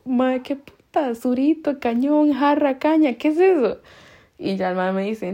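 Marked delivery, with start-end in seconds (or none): 0.0-3.8 higher-pitched, baffled retelling; acted bewilderment. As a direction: act out total confusion at unknown words. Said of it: "Me quedo yo con un cortocircuito". (desconcierto actuado, con voz más aguda)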